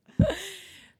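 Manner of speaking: a loud high laugh. Real, not acted. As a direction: burst out laughing. After the joke. (carcajada fuerte)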